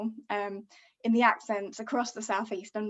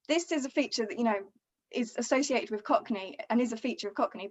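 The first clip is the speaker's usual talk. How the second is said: ordinary talk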